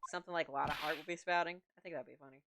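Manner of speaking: ordinary delivery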